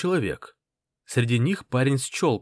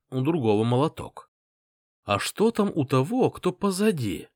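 ordinary delivery, nothing marked

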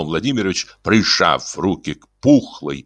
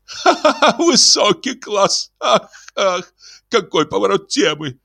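very high, delighted laugh into gush; 14 semitones higher than usual. Acted. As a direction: burst out laughing with glee. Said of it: "заливаясь смехом". (заливаясь смехом, очень высоко)

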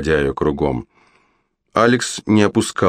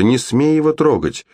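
much higher desperate shout; shouted warning; 8 semitones higher than usual. Acted. Mother shouts at the witch not to touch her boy. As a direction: yell it to protect your son. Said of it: "закричала Шарлотта".

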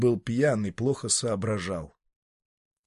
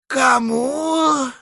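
drawled; 7 dB louder than usual. Acted. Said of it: "протянул Дитер".

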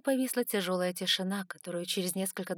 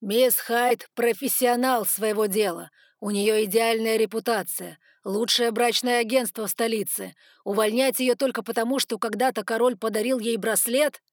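loud, insistent public defence; amplified voice; 3 semitones higher, 9 dB louder than usual. A narrator's reading. Noah defending Miss Hyde at the table, amplified voice. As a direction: project forcefully to the whole hall. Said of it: "голос его был усилен".

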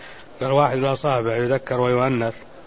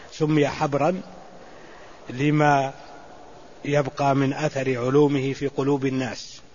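ordinary delivery

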